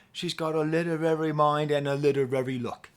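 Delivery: put-on British accent. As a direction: attempt the Irish band's line, landing on a British accent. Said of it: "I'm doing with a British accent, not with an Irish accent".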